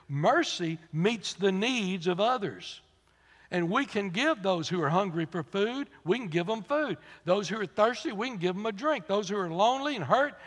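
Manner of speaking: rhythmic, rising preaching litany. Acted. Preacher listing mercy's works, rhythmic and rising. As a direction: hammer each line of the list.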